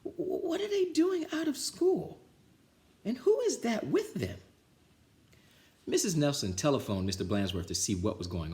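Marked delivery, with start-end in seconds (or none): 0.0-4.4 very high, stammering scratchy voice; scratchy stammering old lady; scratchy shocked voice. Acted. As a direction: sputter it out in disbelief. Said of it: "she said in a scratchy voice".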